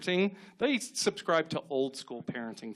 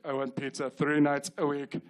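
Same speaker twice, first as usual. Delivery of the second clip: Alan Rickman impression; 3 semitones lower than usual. Child negotiating with parents as a Die Hard villain. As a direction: dictate terms like a movie hostage-taker. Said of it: "when Alan Rickman is talking to the F B I".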